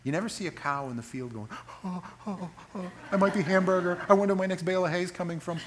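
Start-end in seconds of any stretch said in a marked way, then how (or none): 1.8-5.6 worried cow. Pretend. Cow voiced worrying about food.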